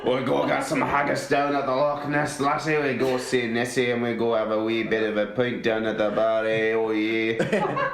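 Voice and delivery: rambling cartoon Scottish accent. Host reeling off Scottish clichés in mock Scottish accent. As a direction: ham up the Scots, lilting along. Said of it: "What about Scottish?".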